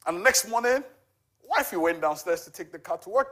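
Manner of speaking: ordinary delivery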